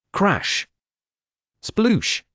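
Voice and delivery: high, playful crash and splash; crash sounds. A narrator's reading. Narrator voicing the tower crashing down. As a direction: make the tower fall with sound.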